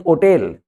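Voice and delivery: होटल said as "o-TEL"; silent H. Shown as a demonstration. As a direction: open होटल on O, no H, then "tel". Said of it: "इसका जो accent है वो है होटेल". (होटल को बिना एच "ओटेल" बोलें)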